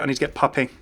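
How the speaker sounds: ordinary talk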